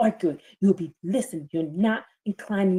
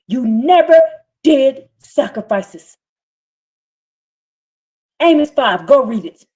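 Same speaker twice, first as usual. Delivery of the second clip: preaching at full force; 6 semitones higher, 7 dB louder than usual. Acted. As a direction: thunder the scripture over the congregation.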